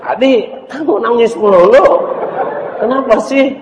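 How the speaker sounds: high, loud, laughing mother's voice; teasing mother. Acted. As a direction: tease the crying boy kindly. Mother asks crying boy.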